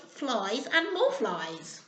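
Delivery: ordinary delivery, nothing marked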